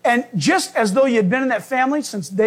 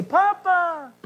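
crying out Papa; 7 semitones higher than usual. A narrator's reading. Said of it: "the Holy Spirit cries out".

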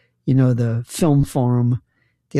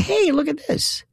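high-pitched "look what I found"; excited call-out; 13 semitones higher than usual. Retold or imitated. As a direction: call it out high to your companion. Retold call to his wife.

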